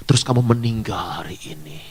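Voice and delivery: ominous whisper of death; hushed whisper. Retold or imitated. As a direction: whisper it like a dark threat. Lecturer frightens student about death.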